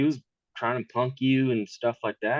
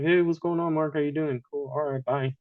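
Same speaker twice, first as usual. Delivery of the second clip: higher-pitched token friendliness, acted out; perfunctory small talk, pitched up; brief polite greeting; 6 semitones higher than usual. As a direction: mimic a quick hello and goodbye. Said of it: "just kept it short".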